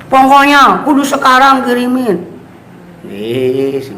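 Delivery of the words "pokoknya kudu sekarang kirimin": high-pitched mock demand; acted pushy whine, pitched way up; insistent demand. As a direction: insist like a child who won't wait. Acts out a demanding child.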